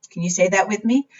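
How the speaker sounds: plain reading voice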